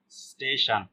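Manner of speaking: स्टेशन with "s" running straight into "t"; half S in स्टेशन. Shown as a demonstration. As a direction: say स्टेशन slowly, no vowel before the s. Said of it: "आधा स का".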